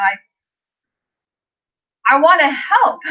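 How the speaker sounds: heartfelt urge welling up; emotional. Real, not acted. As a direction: blurt it out as feeling breaks through. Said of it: "I'm starting to cry a little bit".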